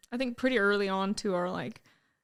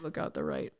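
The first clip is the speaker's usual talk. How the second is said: pilot voice; 6 semitones lower, 5 dB louder than usual. Retold or imitated. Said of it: "You gotta do the pilot voice".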